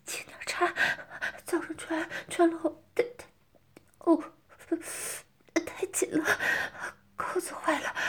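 high breathless stammering with moans; strained, gasping. Acted. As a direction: stammer through gasps, struggling to hold in cries. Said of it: "脸却已经憋得通红了".

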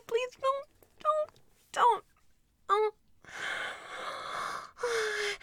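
pleading in a high voice; begging high and breathless. Acted.

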